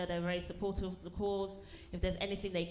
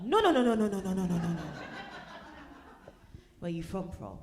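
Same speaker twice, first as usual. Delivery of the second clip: loud rapid no-nos then the pointed question; mock insistent turnaround; 4 dB louder than usual. Comic to a Cornish woman at a party, mock insistent. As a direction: throw her own question back, pushy. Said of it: "I was like".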